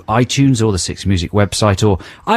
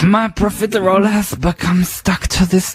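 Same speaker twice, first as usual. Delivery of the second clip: creepy singer parody; 9 semitones higher than usual. Acted. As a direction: purr it high like a sleazy singer. Mocking the song's creepy singer.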